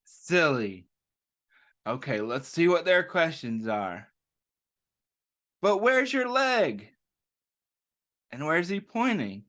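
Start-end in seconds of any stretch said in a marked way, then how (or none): 5.6-7.0 put-on high, eager kid asking; curious kid voice. A narrator's reading. Reading to children, a kid asks Joe curiously. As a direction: voice a puzzled child. Kids question Joe in story.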